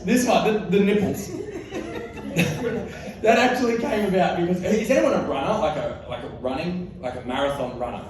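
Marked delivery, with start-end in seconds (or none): 1.4-3.2 laugh. Real, not acted. Comic laughing at own tic.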